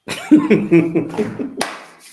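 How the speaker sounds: a loud breathy laugh. Real, not acted. Laughs at video call.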